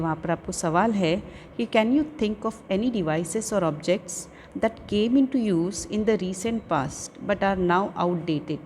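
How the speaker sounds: ordinary delivery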